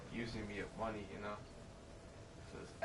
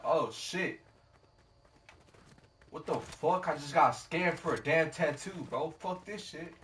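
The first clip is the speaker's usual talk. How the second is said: louder, higher scammed indignation; retold pissed-off outburst; 5 semitones higher, 7 dB louder, slower than usual. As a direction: replay cursing over being ripped off. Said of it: "And now I'm pissed".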